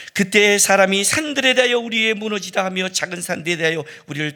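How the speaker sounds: urgent declaimed shout of scripture; strained crying out. Acted. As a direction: squeeze out all your strength to be heard over a noisy crowd. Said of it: "힘을 짜내서".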